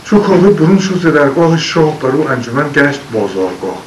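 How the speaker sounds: poem recitation. Acted. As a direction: recite it like old epic poetry. Recites Shahnameh verse. (خوانش حماسی شعر)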